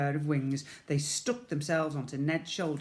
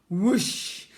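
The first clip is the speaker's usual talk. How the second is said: whoosh; 6 semitones higher, 8 dB louder than usual. Acted. Slide sound effect.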